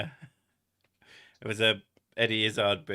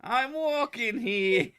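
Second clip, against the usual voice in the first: very high, slow, loud New York shout; hammed-up New Yorker yelling at a car; New York accent; 14 semitones higher, 6 dB louder, slower than usual. Mocking a New Yorker for a friend, loud, slow New York accent. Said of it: "the New York one".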